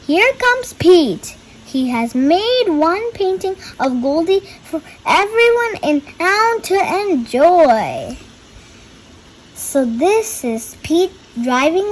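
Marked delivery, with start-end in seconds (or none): 0.1-8.2 excited. A narrator's reading. Triumphant story climax.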